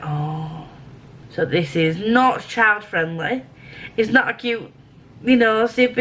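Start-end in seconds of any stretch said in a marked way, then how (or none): 0.0-0.7 soft whispered reaction; hushed dismay. Retold or imitated. Retelling the next episode coming on, hushed dismay. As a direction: let it out under the breath.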